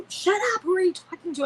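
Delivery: put-on high-pitched, incredulous kid's challenge; mock sibling scolding. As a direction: imitate a brother or sister telling her off. Acting siblings scolding her.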